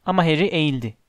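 ordinary delivery

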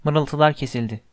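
usual speaking voice

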